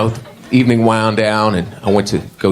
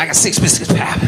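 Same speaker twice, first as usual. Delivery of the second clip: higher-pitched acted gambling call; gambler's bet; 6 semitones higher than usual. Mimicking biscuit gamblers' bets.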